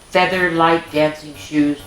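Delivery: ordinary delivery, nothing marked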